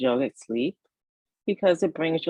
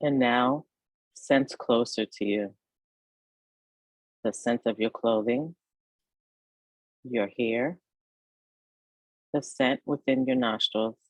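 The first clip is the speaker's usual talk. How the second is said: guided meditation; 2 semitones lower than usual. Acted. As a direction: ease the listener toward rest, phrase by unhurried phrase. Leads a yoga nidra meditation.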